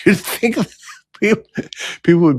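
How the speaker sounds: high, laughing disbelief; real laughter breaking up the speech; incredulous laugh. Real, not acted. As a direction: laugh helplessly through the words. Laughs in disbelief while speaking.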